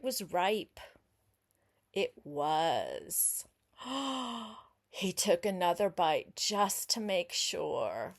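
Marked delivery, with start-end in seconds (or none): 3.8-4.5 a quiet breathy gasp of delight; soft gasp. Acted. Reader gasping at the ripe strawberry for the child. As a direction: gasp softly as if amazed.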